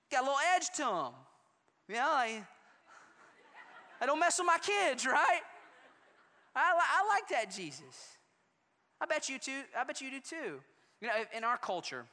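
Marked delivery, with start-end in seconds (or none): 4.0-8.2 high, loud laughing approval. Real, not acted. Preacher laughing with the congregation, loudly. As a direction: chuckle while you say it. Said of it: "laughing about protective parent".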